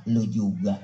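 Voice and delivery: low, laughing retort; laughing comeback. Acted. The speaker's own way of answering an insult, laughing. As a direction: toss it back with a laugh. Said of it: "Kalau kita dikata begitu".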